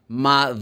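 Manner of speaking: "Mother" with a long buzzing th between the teeth; drawn-out voiced TH. Shown as a demonstration. Teacher showing students how to vibrate the cords, drawn-out voiced th in "Mother". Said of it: "it's like this".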